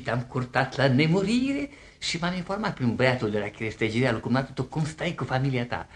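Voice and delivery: normal talking voice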